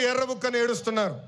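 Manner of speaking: ordinary talk, nothing marked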